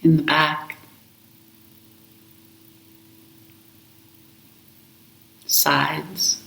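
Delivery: slow, calm meditation voice with long pauses; guided meditation. Acted. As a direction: guide them gently into the breath, unhurried. Leads a guided breath meditation.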